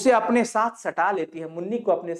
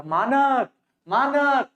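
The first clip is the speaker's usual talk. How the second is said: searching call; 5 semitones higher, much slower than usual. Acted. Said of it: "खोजकर बोल रही है".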